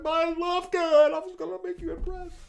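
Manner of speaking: put-on very high, pleading little-kid whine; whiny kid voice. Younger brother pleading with big brother about Love Gun, high childish whine. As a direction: beg like a little brother wanting approval. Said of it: "And I was like".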